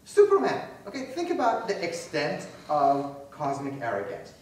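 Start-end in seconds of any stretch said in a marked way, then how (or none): 0.0-0.7 much higher and louder "Superman", falling hard; big theatrical shout of "Superman"; exclaimed name. Acted. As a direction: belt the name out like a reveal. Repeats name as punchline.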